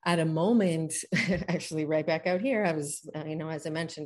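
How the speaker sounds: ordinary talk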